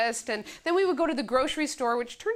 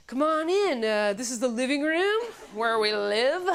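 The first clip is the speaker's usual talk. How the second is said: hammed-up sitcom father tour; corny sitcom dad welcoming baby home; cheesy TV dad. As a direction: play a corny TV dad showing the house. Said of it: "felt like a dad in some bad T V movie".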